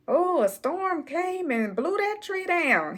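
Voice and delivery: dad repeating his excuse, mock innocent; imitating dad. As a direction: play dumb like the dad. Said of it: "same exact story, the same way".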